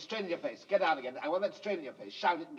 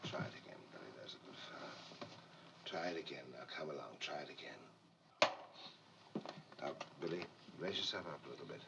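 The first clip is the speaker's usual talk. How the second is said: whispering; 15 dB quieter than usual. Real, not acted. Quiet coaching on set.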